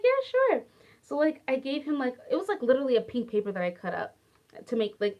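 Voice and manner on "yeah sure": chirpy yes. Retold or imitated. Answering the student warmly.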